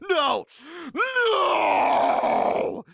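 high shout, second one stretched into a howl; hammed-up refusal. Poster shaking off his girlfriend's hand, melodramatic angry howl. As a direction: refuse like a tantrum boiling over. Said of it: "I jerk my shoulder, shaking her hand off".

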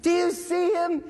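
slow, high shouted question; yelling. Retold or imitated. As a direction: yell it across the street, drawn out. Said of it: "He's like".

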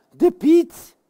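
high, exclaimed reveal; excited announcement. Pretend. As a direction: exclaim it as a big surprise. Kondigt terugkeer van Piet aan. (opgewonden uitroep)

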